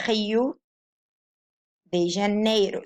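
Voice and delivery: Rio with an H-like R, Janeiro with a tapped R; Portuguese pronunciation. Shown as a demonstration. Teacher showing her city's name, Rio starting like H. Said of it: "the sound is like H".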